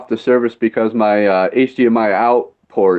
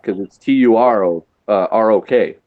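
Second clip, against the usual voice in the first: ordinary talk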